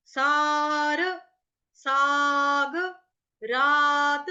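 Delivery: सार, साग, रात each with a long held aa; lengthened vowels. Shown as a demonstration. Teacher shows where vowels lengthen, सार, साग, रात drawn out. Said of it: "स्वर को थोड़ा लंबा करेंगे".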